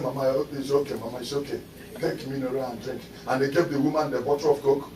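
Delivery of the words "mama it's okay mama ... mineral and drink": lightly voiced soothing. Mourners soothing the crying woman.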